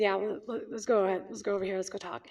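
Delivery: soft, low, fading to near hush; gently steer him aside, voice dropping; quiet retold reply. Doctor leading a tearful patient aside, quietly.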